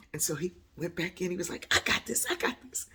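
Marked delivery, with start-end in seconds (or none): 1.7-2.9 high, excited imitation of brother; happy and eager. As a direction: chirp it proudly, high-pitched. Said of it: "he was all happy".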